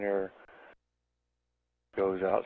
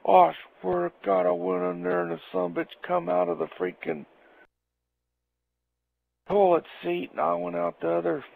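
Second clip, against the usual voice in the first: raised, worked-up buddy voice; voicing Jack; 8 semitones higher than usual. Jack describing the huge skunk, an excited, raised voice. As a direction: spill it like a shaken friend.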